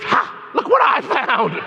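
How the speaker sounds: slow, high, laughing triumph; gleeful find. Retold or imitated. The mind finds the lost name, laughing triumphantly. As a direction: crow with delight at finding it.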